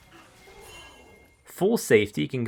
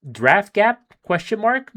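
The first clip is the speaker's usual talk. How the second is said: sarcastic; 10 semitones higher, 7 dB louder, slower than usual. Real, not acted. Sarcastic rhetorical quip.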